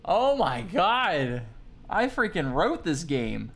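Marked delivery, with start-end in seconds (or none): none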